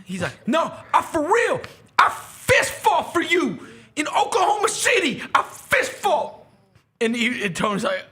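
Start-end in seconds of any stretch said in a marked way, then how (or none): 0.5-6.3 fan's shouted boast. Retold or imitated. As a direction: shout it like a proud superfan. Fan insists he fought for Tony.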